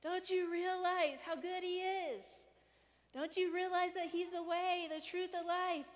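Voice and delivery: mock frustration at people not getting it; exasperated. Preacher imitating our frustrated ranting at others. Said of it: "We're just like".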